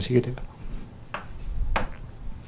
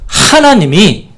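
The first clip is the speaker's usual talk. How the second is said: 하나님이 drawn out very slow, higher and louder; slow emphatic subject; 6 semitones higher, 5 dB louder, much slower than usual. Shown as a demonstration. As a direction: hold 하나님이 long before the rest. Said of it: "두 번째".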